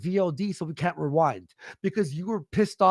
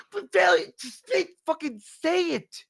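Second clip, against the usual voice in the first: frustrated yell; 10 semitones higher than usual. Retold or imitated. Yelling at Failure in retelling.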